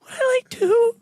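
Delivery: high, teary-sounding squeal that breaks into a laugh; overjoyed squeal. Retold or imitated. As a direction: gush like a fan offered a gift. Overjoyed at being offered two.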